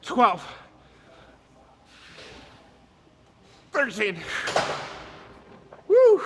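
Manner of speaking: counting through the strain, then a high whoop; straining reps. Real, not acted. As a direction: push out the last reps and whoop.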